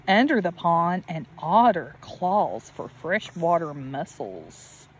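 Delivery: gentle storybook read with a lift on each refrain; reading to children. Acted. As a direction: read it like a bedtime picture book for a little one. Reading picture book to child.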